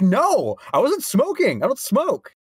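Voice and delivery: high-pitched mock protest; acted squeaky-high indignation; indignant denial. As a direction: insist on your innocence, pitch shooting up. Retells denial to doubting professor.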